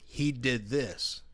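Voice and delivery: slowed, deliberate quote; slow, weighty "He did this"; slow emphasis. Retold or imitated. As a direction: spell out each word slowly. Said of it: "rarely is it".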